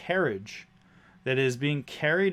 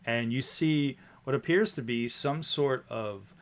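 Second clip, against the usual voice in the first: ordinary talk, nothing marked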